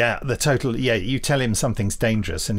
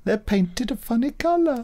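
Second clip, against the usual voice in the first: much higher put-on voice; high mock voice; 13 semitones higher than usual. Host mimics the character's high voice. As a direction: say it like the prissy character.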